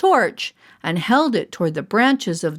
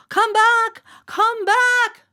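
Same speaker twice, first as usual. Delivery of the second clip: shouting; much slower than usual. A narrator's reading. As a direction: yell into the woods after the kids. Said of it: "they shouted".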